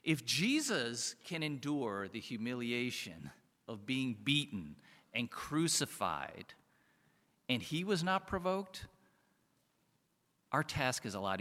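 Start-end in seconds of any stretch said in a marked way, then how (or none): none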